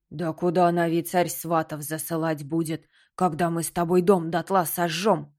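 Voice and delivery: gruff creaky bark. Acted. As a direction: snap it at her like a skull. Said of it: "рявкнул Найдён".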